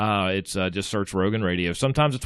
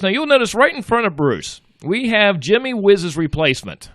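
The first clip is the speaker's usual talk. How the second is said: ring announcer intro; 6 semitones higher than usual. Acted. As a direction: boom it out like introducing a prizefighter. Introducing Alexa as replacement.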